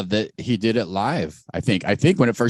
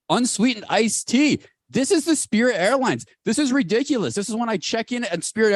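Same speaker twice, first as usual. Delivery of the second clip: high-pitched indignant rant; 9 semitones higher than usual. Retold or imitated. Ranting about the charge.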